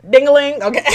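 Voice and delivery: laughing. Real, not acted. Laughing sing-song after slay.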